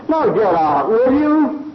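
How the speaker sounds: losing patience, push it up high; impatient blacksmith. Retold or imitated. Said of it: "And the blacksmith said".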